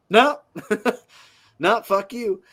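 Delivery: high, loud mock rejection, laughing between; mock blunt refusal. Lakers turning down Caruso's offer, mock blunt refusal. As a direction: imitate a curt brush-off, amused.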